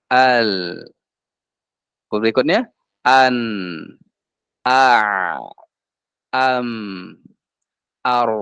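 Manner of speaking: "al, an, A, Am, Ar" with lingering, fading endings; half-flowing letters. Shown as a demonstration. Teacher demonstrates bainiyah, endings of "al, an, Am, Ar" drawn out. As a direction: let each closing consonant ring, then fade. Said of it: "Nah, begitu".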